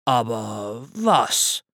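slow, sighed patient bear; sighing. A narrator's reading. Bear sighs at the squirrel's interruption, slow sigh. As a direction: sigh the question out slowly. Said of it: "seufzte Bär".

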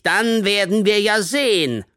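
high, loud parting threat; menacing challenge. A narrator's reading. Teacher warns of Monday's test, menacingly. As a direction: finish the threat with gloating force.

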